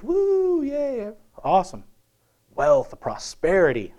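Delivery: high whooping woo, a cheery mock celebration; mock cheer. Preacher mocking wealthy Isaiah, high whooping cheer. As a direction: hoot it like a careless rich man.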